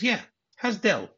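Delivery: high-pitched pointed question; mock pointed insistence. Wife suspecting an affair, pointedly insistent. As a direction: press the question, pitched well up.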